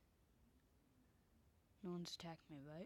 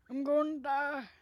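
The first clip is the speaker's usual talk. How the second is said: put-on higher, much louder creaky old man croak on I'm gonna die; 7 semitones higher, 14 dB louder than usual. Shown as a demonstration. Gamer shows off his old man voice, loud and high. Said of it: "It's a very old man voice".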